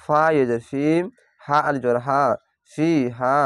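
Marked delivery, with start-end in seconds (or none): none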